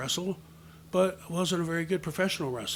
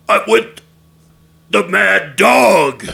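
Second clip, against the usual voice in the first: very loud, higher wrestler voice, last words drawn out; Mad Dog; 5 semitones higher, 17 dB louder, much slower than usual. Retold or imitated. Boy answers backstage in his best imitation, bellowing. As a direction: bellow it slowly as a menacing introduction. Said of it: "in my best, best Mad Dog imitation".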